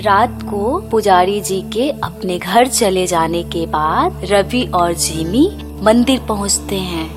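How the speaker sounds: usual speaking voice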